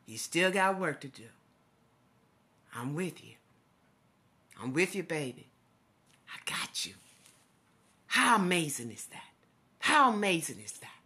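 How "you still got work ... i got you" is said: soft, breathy-free hush, voiced words fading into whisper; God's gentle reassurance, dropping to a whisper; tender whisper. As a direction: hush it like comforting a child. God's comforting words to grieving speaker.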